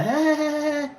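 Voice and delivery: high mocking ha ha ha; mocking laughter. Imitating the hosts jeering at her with high mocking laughter. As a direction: mimic their jeering laugh. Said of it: "they laughing at her like".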